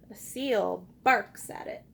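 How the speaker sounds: usual speaking voice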